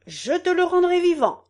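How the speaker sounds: high, ringing proclamation; lofty campaign oratory; candidate's grand promise. Acted. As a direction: declaim the promise to the whole crowd.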